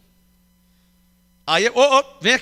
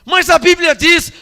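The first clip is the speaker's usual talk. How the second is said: raised, slow protest, higher and louder; indignant protest; 7 semitones higher, 7 dB louder, slower than usual. Retold or imitated. Retelling his brother objecting to the pastor, raised and indignant. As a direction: push back hard, mock-indignant.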